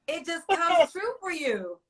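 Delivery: tearing up and laughing it off; tearful laugh. Real, not acted. Host telling Rob not to cry, laughing through her own tears.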